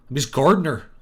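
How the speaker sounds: a rural country accent, lightly done. Shown as a demonstration. Streamer voicing a country-accent gardener line. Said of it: "he talks in a country accent".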